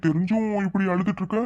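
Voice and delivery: high, soft sneering taunt; taunting menace. Acted. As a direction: toy with her quietly. Thug menacing a crying girl. (மிரட்டலான கேலி)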